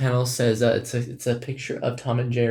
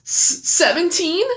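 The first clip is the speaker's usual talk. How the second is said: high, rising, innocent squeak; coy girl voice. Pretend. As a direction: ask it in a high coy little voice. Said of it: "do the voices".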